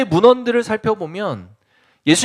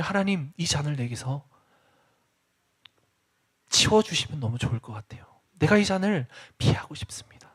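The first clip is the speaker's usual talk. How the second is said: hushed prayer; 7 semitones lower, 9 dB quieter than usual. Acted. Voicing Jesus' anguished prayer.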